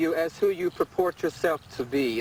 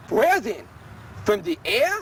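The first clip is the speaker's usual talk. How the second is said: mock anguished questioning; 6 semitones higher, 3 dB louder than usual. Frantic self-questioning, building up.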